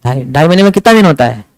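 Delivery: normal talking voice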